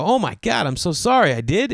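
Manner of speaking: high-pitched, flustered apology; mock frantic apology. Golfer apologising to the old people, mock frantic.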